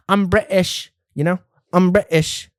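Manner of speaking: a hint of a camp Brit; mock British accent. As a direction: toss it off like a Brit.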